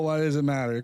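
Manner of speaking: ordinary delivery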